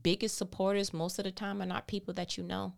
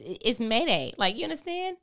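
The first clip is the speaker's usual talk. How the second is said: comic alarm; 6 semitones higher, 8 dB louder than usual. Real, not acted. Comic alarm about crying.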